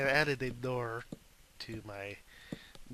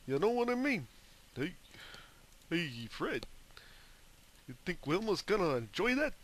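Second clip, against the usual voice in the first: put-on high, goofy Brooklyn accent; hammed-up Brooklyn pal voice; Brooklyn cartoon sidekick; 6 semitones higher than usual. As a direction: play a cartoon buddy from Brooklyn, pitched up. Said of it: "why I'm from Brooklyn all of a sudden".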